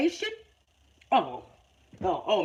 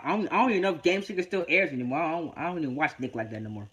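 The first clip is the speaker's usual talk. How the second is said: normal talking voice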